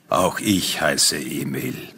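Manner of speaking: low, slow, unruffled reply; stranger's deep voice. Acted. Stranger answering the wife's plea, slow deep voice.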